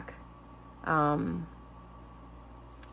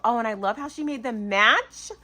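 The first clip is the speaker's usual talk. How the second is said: normal talking voice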